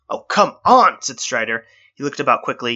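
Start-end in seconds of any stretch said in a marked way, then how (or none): 0.1-1.0 high, loud exasperated groan of a complaint. Acted. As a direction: throw the words up in disbelief. Exasperated at crushed scanner.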